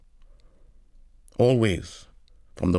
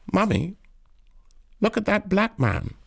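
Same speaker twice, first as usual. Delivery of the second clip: put-on high-pitched small child voice; child's high voice, narrator acting; little child; 12 semitones higher than usual. A narrator's reading. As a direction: pipe up like a child calling out to mother. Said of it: "A little child holding on to the mother's hand".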